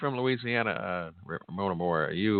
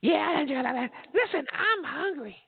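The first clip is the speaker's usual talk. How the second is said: high, slow woman voice. Retold or imitated. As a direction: play the hungry wife, high. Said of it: "And she'll say".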